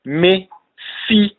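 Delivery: "Me fi" as two separate words with a pause between; word-by-word pronunciation. Shown as a demonstration. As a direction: sound out "Me fi" one word at a time.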